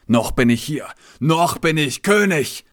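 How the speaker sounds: the king shouts his defiance at Vena; king's outburst. Acted.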